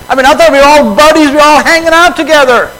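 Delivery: fast, high protest about being buddies; hurt disbelief. Retold or imitated. Disciple protesting to Jesus, fast high hurt disbelief. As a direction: rush it out like a wounded friend.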